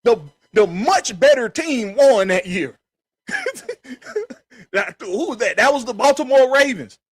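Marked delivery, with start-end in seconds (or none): 3.3-4.3 high, quiet laugh; amused laugh. Real, not acted. Sports host laughing at his own argument, amused laugh. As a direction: let out a soft, high-pitched chuckle.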